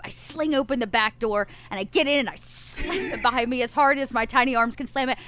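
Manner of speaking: ordinary talk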